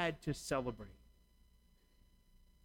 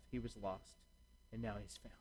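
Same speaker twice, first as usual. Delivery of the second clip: softer, gentle father's reassurance; tender father; 6 semitones lower, 11 dB quieter than usual. Acted. Father reassuring his older son, soft and tender. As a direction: let it fall away softly, like a father consoling.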